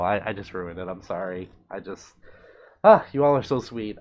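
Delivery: ordinary talk, nothing marked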